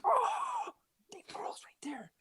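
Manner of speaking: high, awed whisper; starstruck whisper. Retold or imitated. Telling his friend the star is right behind them, awed whisper. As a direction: breathe it out so he won't hear.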